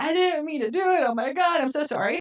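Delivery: high-pitched retold panic; panicking. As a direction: imitate someone freaking out and apologising. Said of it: "is freaking out and panicking".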